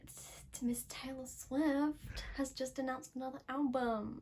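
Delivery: slow, soft unvoiced whisper; hushed announcement. Friend interviewing her, she whispers the Taylor news.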